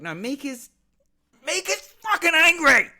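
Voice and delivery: a loud, high burst of passion; emphatic shout. Real, not acted. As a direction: yell it to drive it home. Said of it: "is what I'm trying to say to you".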